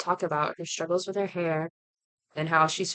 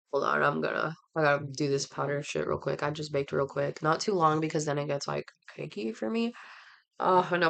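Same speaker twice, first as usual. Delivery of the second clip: usual speaking voice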